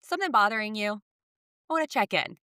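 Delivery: high, sugary mock concern; overly sweet check-in, tongue in cheek. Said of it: "I'm gonna be like".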